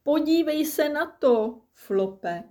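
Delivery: high-pitched jeering call; mildly shouted children's call. A narrator's reading. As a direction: call over to a friend, pitched well up. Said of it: "křičeli".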